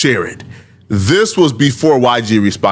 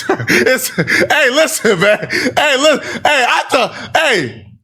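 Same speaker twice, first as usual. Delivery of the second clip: talking through laughter, high; can barely get the words out for laughing; 10 semitones higher, slower than usual. Real, not acted. Cracking up at own point.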